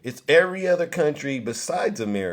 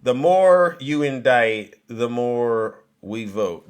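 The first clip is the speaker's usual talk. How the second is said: slow reading; 3 semitones lower, 3 dB louder, slower than usual. Retold or imitated. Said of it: "Someone wrote".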